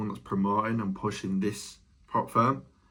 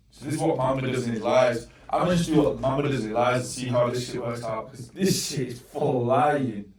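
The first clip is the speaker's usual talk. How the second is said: ordinary delivery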